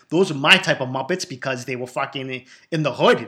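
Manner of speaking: ordinary talk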